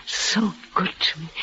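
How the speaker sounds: hushed, tender whisper; soft whispered repeat. Acted. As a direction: breathe the line softly.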